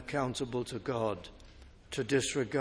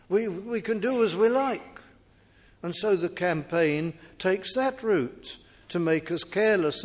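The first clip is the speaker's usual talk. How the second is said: raised, driving preacher's voice; preaching; 6 semitones higher, 5 dB louder than usual. Acted. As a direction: preach it out to the congregation. Preaching at full force.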